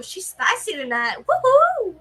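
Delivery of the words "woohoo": high, loud whoop; playful cheer. Real, not acted. Co-host cheering on the host's spicy moment, playful whoop. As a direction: whoop to egg her on.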